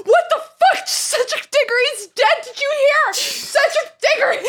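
very high, loud, frantic gossip; a crowd losing its mind; panicked crowd. Retold or imitated.

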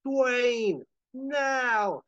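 high, drawn-out urgent yell; top-of-the-voice yell. Acted. Howard calls Dwayne by the machine, yelling at the top of his voice. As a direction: bellow the cue across the stage. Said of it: "yelling at the top of his voice".

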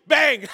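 loud shouted bang; mock gunshot. Pastor acts the child firing the gun, loud mock bang. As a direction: blurt out a gunshot noise, playfully. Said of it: "Micah take the gun and say".

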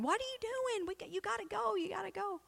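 high, impatient mock crowd voice; grumbling crowd. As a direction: hurry him along as the crowd.

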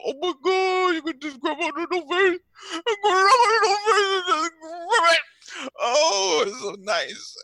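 very high squealing gush; gushing over the cat. Acted. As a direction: squeal with adoration over the little face.

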